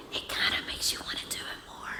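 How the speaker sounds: hushed, unvoiced confession; whispered aside. Real, not acted. Preacher confiding a forbidden urge to congregation, whispered. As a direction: whisper it like a guilty secret.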